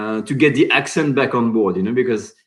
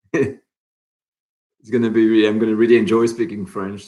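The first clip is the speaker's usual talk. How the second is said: ordinary delivery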